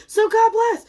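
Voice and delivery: exclaimed God bless; loud blessing. Real, not acted. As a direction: call it out bright and high.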